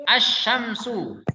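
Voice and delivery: "asyamsu" slow and sustained, the sh doubled; tajwid reading. Shown as a demonstration. Teacher models the Quranic word for pupils, drawn out. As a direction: draw out "asyamsu" with no l. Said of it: "alif lam syamsiah".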